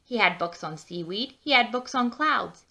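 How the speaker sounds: plain reading voice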